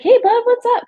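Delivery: high-pitched, casual hello to a brother; retold upbeat phone pickup; bright phone greeting. Sister answering little brother's late call, cheerful greeting.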